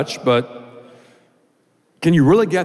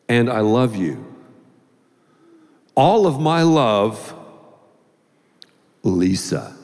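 reading a love letter; 2 semitones lower, slower than usual. Acted. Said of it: "and it said".

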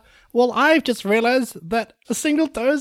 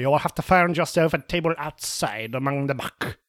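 low, hammy French waiter voice; French accent; 8 semitones lower than usual. Acted. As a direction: put on a thick French accent. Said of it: "I am losing this accent".

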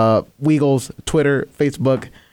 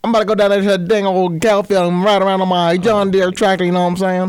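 bad country accent; 5 semitones higher, faster than usual. Shown as a demonstration. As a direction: put on a failed Southern accent. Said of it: "you ever heard my country accent".

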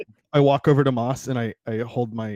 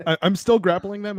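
ordinary delivery

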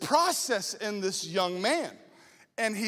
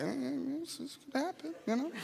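hedging quietly; 3 semitones higher, 10 dB quieter than usual. Retold or imitated. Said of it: "And I was like".

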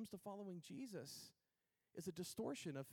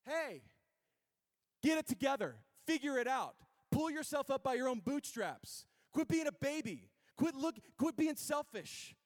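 loud, high, barked orders; the harsh world; 8 semitones higher, 8 dB louder than usual. Acted. The world tells the weary to toughen up, shouting. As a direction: shout demands like an impatient drill coach. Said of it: "You know what the world says".

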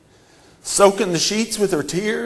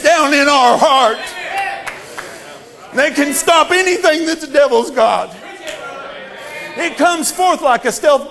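loud, higher preacher's shout; shouting sermon climax; full-force preaching; 7 semitones higher, 5 dB louder than usual. Acted. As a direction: build to a roaring climax.

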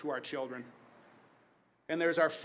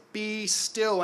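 a commanding call, higher and a touch louder; powerful command; 5 semitones higher, 4 dB louder than usual. Retold or imitated. Jesus commanding the storm, a powerful voice. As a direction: order the storm to stop with authority. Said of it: "He says in that powerful voice".

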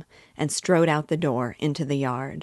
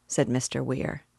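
usual speaking voice

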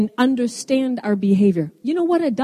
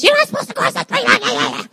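much higher, frightened scolding shout; parent yelling; 14 semitones higher, 3 dB louder than usual. Retold or imitated. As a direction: shriek it like a scared parent grabbing the child. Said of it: "yells at us".

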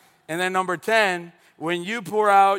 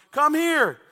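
high, raised calling voice; called-out summons; 10 semitones higher, 4 dB louder than usual. Retold or imitated. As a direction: call a friend over from next door. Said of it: "I would scream at Adrian".

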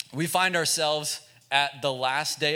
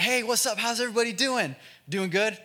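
upbeat youth pastor; 8 semitones higher than usual. Retold or imitated. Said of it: "He's like".

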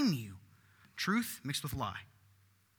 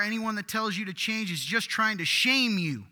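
mock proclamation; 5 semitones higher, 7 dB louder than usual. Quoting a false teaching loudly.

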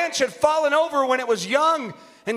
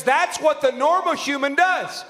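rhythmic, rising preacher's list; preaching; 4 semitones higher than usual. Real, not acted. Preacher listing what a normal believer does, rhythmic preaching. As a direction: pile up each point with stretched endings.

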